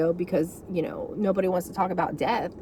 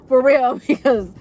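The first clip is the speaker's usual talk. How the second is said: laughing while saying it; 6 semitones higher, 7 dB louder than usual. Real, not acted. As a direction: break into a laugh mid-line. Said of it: "I wanna get a shirt".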